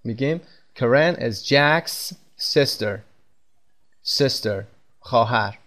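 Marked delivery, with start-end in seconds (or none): none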